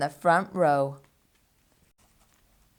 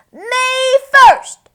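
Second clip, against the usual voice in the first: high, loud "Me first!"; greedy piglet shout; excited squeal; 9 dB louder than usual. A narrator's reading. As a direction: shriek it with greed. Pig racing for sandwich.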